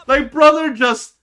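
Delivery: can't believe what he just saw; exasperated cry at a fumbled play. Real, not acted. Exasperated at a blunder.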